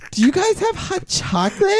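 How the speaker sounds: very high, grating customer voice; annoying girl voice. Retold or imitated. Customer ordering at the coffee shop, annoying high voice. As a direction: pitch it way up, irritatingly. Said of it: "super annoying voice".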